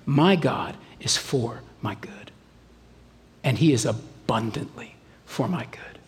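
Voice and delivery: soft, low whisper to your own heart; low, hushed reassurance; whispered self-talk. Shown as a demonstration. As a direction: whisper the truth to yourself. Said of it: "tell your heart the truth".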